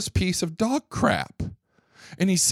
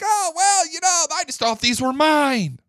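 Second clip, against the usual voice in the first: mock innocence; 8 semitones higher than usual. Mocking Comey's excuse.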